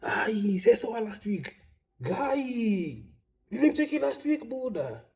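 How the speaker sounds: high-pitched mock disbelief, drawn out in places; shocked mourners. Condolence callers questioning the bereaved, in exaggerated high-pitched shock. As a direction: imitate astonished acquaintances wailing their surprise. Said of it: "people coming to you and they're like".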